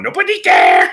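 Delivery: very high, loud parrot squawk; a bird shrilly echoing the words; parrot mimicking. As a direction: screech it like a parrot copying what it heard. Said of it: "the bird's just strolling around its cage willy-nilly going".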